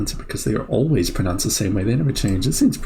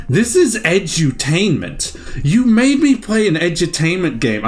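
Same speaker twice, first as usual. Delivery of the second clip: mock indignant; 8 semitones higher than usual. Real, not acted. Host complaining to the coin, higher mock indignation. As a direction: complain at being tricked.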